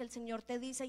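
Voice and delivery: usual speaking voice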